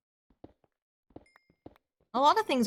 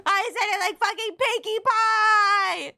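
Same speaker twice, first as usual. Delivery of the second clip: high, drawn-out wail building louder; exasperated squeal; 13 semitones higher, 4 dB louder than usual. Real, not acted. Streamer appalled at sounding like a cartoon, high exasperated wail. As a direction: squeal it out in disbelief at yourself. Exasperated at her own slip.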